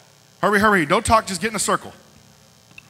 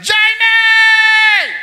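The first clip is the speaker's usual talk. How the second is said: high, loud call across the room; yelled name; 11 dB louder than usual. Retold or imitated. Friend yelling Jamie across the church, joyful shout. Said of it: "came across the room and yelled".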